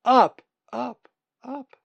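high echoes dying away; fading echo. A narrator's reading. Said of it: "they echoed".

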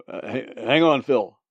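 loud stammered call; shouted. A narrator's reading. Man shouts to Phil during bird attack, loud and flustered. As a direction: shout it while stumbling over the words. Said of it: "the other one shouted".

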